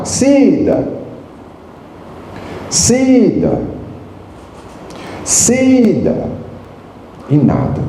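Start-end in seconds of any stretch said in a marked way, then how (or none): 0.0-6.3 calling out. Retold or imitated. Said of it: "comecei a chamar".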